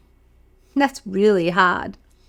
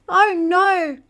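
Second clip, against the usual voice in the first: mock dismay; 7 semitones higher than usual. Storyteller as wolf swallows girl, mock alarm. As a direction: cry it out in playful alarm. Narrator reacting for kids.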